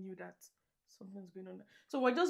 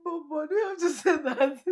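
laughing protest; 6 semitones higher, 9 dB louder than usual. Real, not acted. Reacts to partner's joke.

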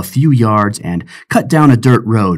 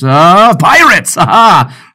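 slow, louder, higher burst; triumphant reading; 8 semitones higher, 7 dB louder, slower than usual. Acted. Pirate James reading the letter aloud, triumphant outburst. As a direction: pounce on the proof.

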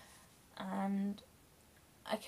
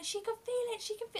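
imitating mum's shout; 12 semitones higher than usual. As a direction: pitch it way up like a frantic mum. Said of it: "my mum was shouting at him".

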